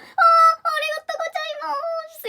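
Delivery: high, drawn-out Japanese thank-you; gushing thanks. Retold or imitated. Thanking the stranger who buttoned the poncho, high-pitched gushing. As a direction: stretch a grateful squeak upward.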